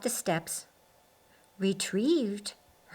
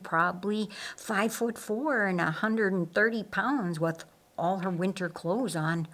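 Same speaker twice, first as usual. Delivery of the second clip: ordinary delivery, nothing marked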